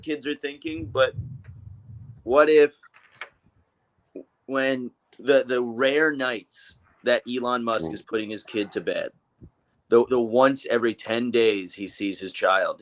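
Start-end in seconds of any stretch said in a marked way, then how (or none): none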